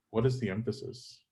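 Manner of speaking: ordinary talk, nothing marked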